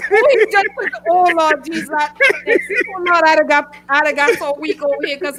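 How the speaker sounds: gasping through laughter; words squeezed out laughing; laughing hard. Real, not acted. Laughing fit.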